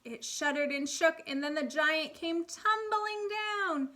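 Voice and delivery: put-on lively storybook reading for kids; animated read-aloud for first graders; reading to children. A narrator's reading. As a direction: read it like a picture book at storytime. Teacher reads fairy tale aloud.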